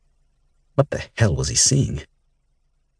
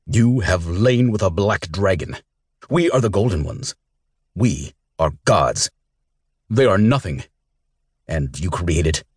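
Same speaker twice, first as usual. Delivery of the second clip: roaring tyrant king; 2 semitones higher than usual. A narrator's reading. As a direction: pronounce judgment like a proud god-king. King condemns her at trial.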